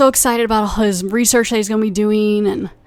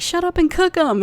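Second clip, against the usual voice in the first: much higher, put-on heckler voice; 9 semitones higher than usual. Someone in the crowd heckles, high put-on voice. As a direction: yell it like an impatient heckler.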